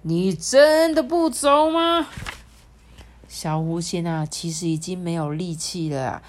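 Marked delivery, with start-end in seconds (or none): 0.0-2.1 slow, loud stern demand; slow loud stern question; stern shout. Acted. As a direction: bark it sternly and slowly. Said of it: "厉声喊道说".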